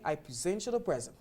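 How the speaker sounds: first present as pri-ZENT, last present as PREZ-ent; contrasting word stress. Shown as a demonstration. Teacher reads the example sentence, verb pri-ZENT then noun PREZ-ent. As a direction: shift the stress between present and present. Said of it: "the stress falls on the second syllable".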